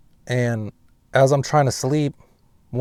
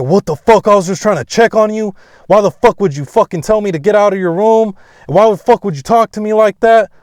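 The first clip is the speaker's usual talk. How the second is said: loud, high-pitched mock scolding; dad's angry rant, acted; 9 semitones higher, 8 dB louder than usual. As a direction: imitate an indignant dad telling someone off. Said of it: "then he just starts talking shit".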